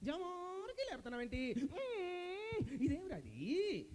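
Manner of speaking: soft squeaky-high, drawn-out whimpering query; high mock whine. Host repeats query in mock distress.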